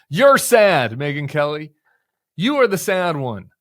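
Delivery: loud scolding, fired up; angry rant. Real, not acted.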